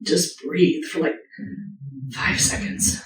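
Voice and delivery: softly paced meditation voice, easing them into calm; guided meditation. Acted. Speaker guides listeners into self-compassion meditation, soft and calm. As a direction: talk them gently into a breath.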